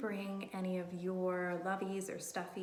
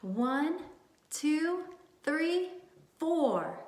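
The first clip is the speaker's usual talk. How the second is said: cheerful count; 6 semitones higher, 4 dB louder, much slower than usual. Shown as a demonstration. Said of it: "let's count to four".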